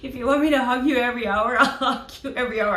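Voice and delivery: high, fast and giggling; giddy offer. Pretend. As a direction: gush at him through laughter. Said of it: "I'm just gonna go".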